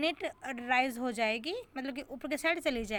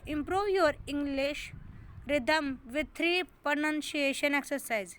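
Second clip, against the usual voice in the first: slow heading read; much slower than usual. Shown as a demonstration. Teacher reads the exercise heading.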